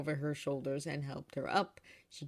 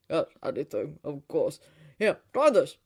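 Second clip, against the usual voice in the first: eager father; 2 semitones lower, 8 dB louder than usual. A narrator's reading. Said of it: "Frex was eagerly jubilant".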